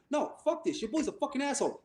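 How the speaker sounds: mock angry refusal. Angry friend storming out, retold.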